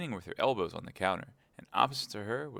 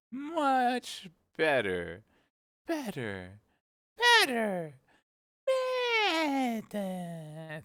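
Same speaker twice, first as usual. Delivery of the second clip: much higher, slow squeak ending in a bleat; squeak into a sheep's bleat; 6 dB louder, much slower than usual. A narrator's reading. The Queen turning into a sheep, squeak into a bleat. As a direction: let the voice climb to a squeak and bleat. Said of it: "her voice rising into a squeak".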